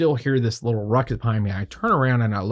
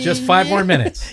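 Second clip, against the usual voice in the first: joking plea; 8 semitones higher, 5 dB louder than usual. Retold or imitated. Joking plea to keep shooting.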